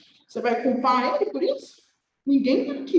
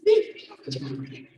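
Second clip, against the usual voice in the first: much lower, mock menacing threat; grandma threatening; 11 semitones lower than usual. Nurse re-enacts grandma waving the tube at doctors, low mock threat. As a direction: growl it like a cornered patient. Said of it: "eu com um tubo assim".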